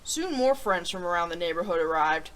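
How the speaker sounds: put-on reading a picture book to children; storybook read-aloud voice; story narration. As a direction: tell it gently to young listeners.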